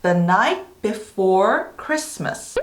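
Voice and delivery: very slow, word by word; slow, evenly spaced teaching delivery; slow demonstration. Shown as a demonstration. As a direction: read the title slowly for learners, pausing between words. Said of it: "제목부터 한번 살펴보도록 할게요".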